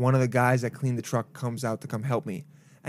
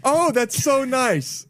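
awkward flattered comedian; 12 semitones higher, 4 dB louder, much slower than usual. Retold or imitated. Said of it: "He'd be like".

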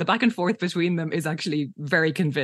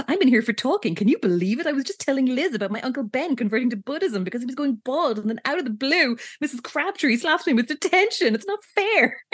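high-pitched, indignant chatter; babbling; 6 semitones higher than usual. Retold or imitated. Enid babbles to Cheryl about her detention, aggrieved. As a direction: imitate a wronged chatterbox, climbing higher to the end. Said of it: "she starts babbling away to Cheryl".

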